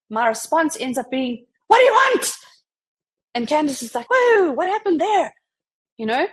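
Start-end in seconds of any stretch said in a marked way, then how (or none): 1.7-2.3 much higher and louder, curt and fed up; snappy irritated retort. Retold or imitated. Overloaded Candace snapping at Joe's greeting, irritated high bark. Stressed colleague snaps back at greeting. 4.1-5.3 much higher, louder, taken aback; startled surprise. Retold or imitated. Candace reacting to her own sharp reply, startled and higher.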